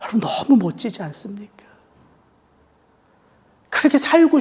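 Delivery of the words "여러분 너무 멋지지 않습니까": hushed and amazed; whispered awe to the congregation; awed whisper. Real, not acted. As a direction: whisper it, full of wonder. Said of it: "whispered awe to congregation".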